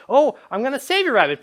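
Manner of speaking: high, loud mock heroics; devoted rescuing player. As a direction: play a gushing hero to the rescue. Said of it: "the intended drama here is".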